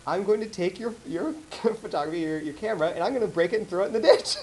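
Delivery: high, laughing through a mock threat; the angry dad threatens to smash the camera, retold while laughing. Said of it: "he goes".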